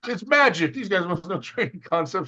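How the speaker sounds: high-pitched clueless wonder; mock amazement. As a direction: exclaim as a baffled loser awed by a mystery. Voicing baffled losers' excuses.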